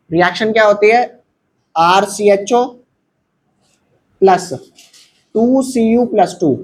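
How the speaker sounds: ordinary delivery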